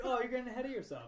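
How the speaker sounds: quick, high teasing quip; playful quote. Retold or imitated. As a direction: toss it off fast and playful. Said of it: "I was like".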